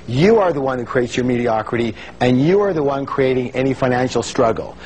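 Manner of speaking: normal talking voice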